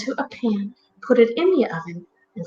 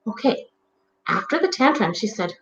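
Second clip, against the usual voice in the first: usual speaking voice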